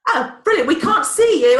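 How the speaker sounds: real relieved laugh as the guest appears; laughing while telling the guest his camera is off; laughing relief. Real, not acted. Relieved guest finally joins call.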